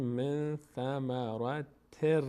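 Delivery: slow من ثمرتر ending on a held r; tanwin merged into ra. Shown as a demonstration. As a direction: draw out من ثمرتر syllable by syllable.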